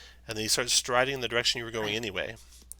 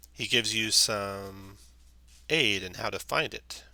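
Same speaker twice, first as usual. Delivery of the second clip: ordinary delivery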